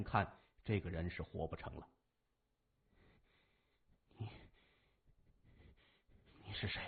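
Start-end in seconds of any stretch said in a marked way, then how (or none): 4.2-6.8 faint, broken, barely voiced question; dying, weak voice. A narrator's reading. Dying man asks who stands before him, faint and halting. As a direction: force out each word with failing strength. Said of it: "刘书俊失血过多".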